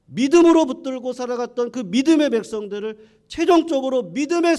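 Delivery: loud, high, fervent preaching; rising sermon fervor; preaching at full force. Acted. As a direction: drive the message home to the congregation. Preaching climax.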